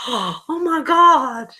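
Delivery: gasp, then high, slow "oh my God"; amazed at the gift, gasping; gasping surprise. Real, not acted. Gasps at the bobblehead.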